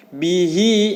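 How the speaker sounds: "Bihi" with the final i stretched out; long kasroh. Shown as a demonstration. Teacher models long kasroh for pupils, drawn-out vowel. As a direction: hold the "hi" of "Bihi". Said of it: "kasroh panjang".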